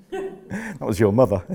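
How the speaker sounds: slow, laughing delivery; laughing tease. Real, not acted. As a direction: tease them while chuckling. Teasing his listener through laughter.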